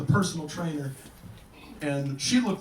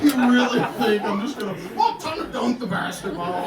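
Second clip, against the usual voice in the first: incredulous; 5 semitones higher, 4 dB louder than usual. Real, not acted. Incredulous at the suggestion.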